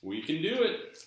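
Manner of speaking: high, loud hammed-up Southern accent. Vlogger offers viewers Southern accent videos, mock Southern accent. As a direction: ham up a Southern drawl for fun. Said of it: "in a Southern accent".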